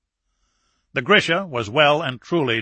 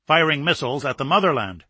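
ordinary delivery

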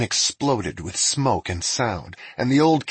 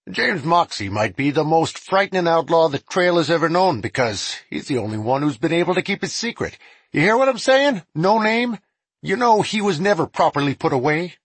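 deputy voice; 8 semitones higher, 4 dB louder than usual. Acted. Deputy character voice.

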